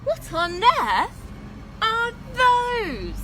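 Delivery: slow, rising, loud amazement; astonished girl. A narrator's reading. Katy spotting the huge dinosaurs, loud and amazed. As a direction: gasp it out in disbelief. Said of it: "asked Katy".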